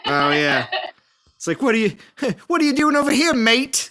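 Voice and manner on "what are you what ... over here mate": high, quick mock Australian with a laugh; Australian accent. Teasing a deer over the fence, mock Australian. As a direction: put on a laughing Aussie accent. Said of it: "you guys are Australian".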